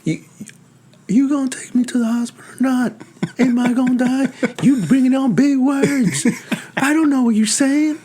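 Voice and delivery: high, strained voice of a confused patient. Retold or imitated. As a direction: play the scared patient who can't follow big words. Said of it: "That's a good voice you did".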